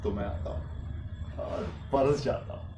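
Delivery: lingering, lyrical self-introduction; poetic recital. Acted. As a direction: introduce yourself like a mysterious poet.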